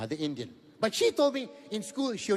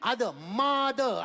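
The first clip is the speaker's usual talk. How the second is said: other, mother drawn out slowly; slow reveal; 6 semitones higher, much slower than usual. Shown as a demonstration. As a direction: stretch out other, mother as a punchline. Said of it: "I put an M".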